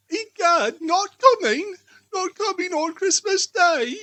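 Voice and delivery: theatrical groan of disbelief; mock dismay. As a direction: play the crushed father for laughs. Father fakes dismay at Martha.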